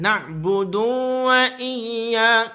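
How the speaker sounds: "Na'budu wa iya" chanted with over-held vowels; wrongly lengthened vowels. Shown as a demonstration. Teacher shows the common mistake of lengthening "Na'budu wa iya", drawn out.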